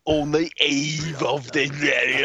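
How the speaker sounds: slow, drawn-out mock British accent. Mocking a metal singer's British rant, slow hammed-up British accent. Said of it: "he's like".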